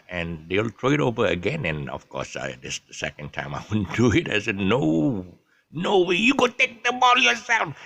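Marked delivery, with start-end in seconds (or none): 4.6-7.7 put-on high, loud refusal in local Manglish accent; local Malaysian accent. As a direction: shout back in a Malaysian local accent, pitched way up. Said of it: "Well, I speak local accent".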